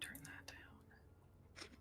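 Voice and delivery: hushed urgent whisper; whisper yelling. Real, not acted. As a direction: whisper it sharply, like a scold. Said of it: "Why are you whispering?".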